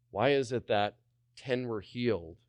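usual speaking voice

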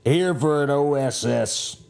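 ordinary talk

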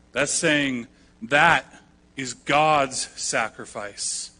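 ordinary talk, nothing marked

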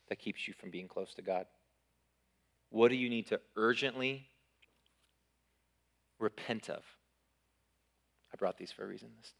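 a pastor quietly inviting the church to repent; hushed altar call. Acted. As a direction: draw them in gently before the response.

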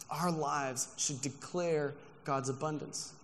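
slow, deliberate restatement; slow emphatic repeat. Shown as a demonstration. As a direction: lay each word down slowly.

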